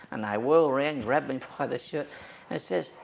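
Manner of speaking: normal talking voice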